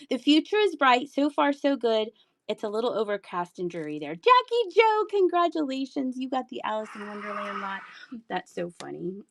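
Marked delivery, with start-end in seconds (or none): none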